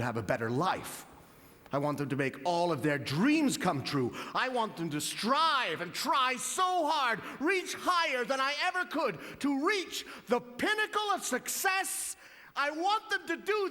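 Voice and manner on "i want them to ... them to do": rising grandeur. Acted. Mock-grand hopes for his kids.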